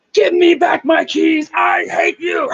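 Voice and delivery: slow hoarse yell, imitating; mock furious shout. Girlfriend screaming for her keys, hammed-up yell. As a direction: ham up a raging girlfriend's shout. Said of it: "We see Heather".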